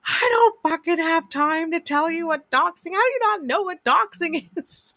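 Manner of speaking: incredulous at the police; exasperated rant. Retold or imitated. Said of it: "and I'm like".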